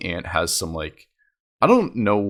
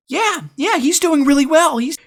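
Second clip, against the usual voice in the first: very high put-on aunt voice; aunt imitation; 4 dB louder than usual. Aunt backs up cousin, high mock-approving voice. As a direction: mimic an approving aunt, pitched way up.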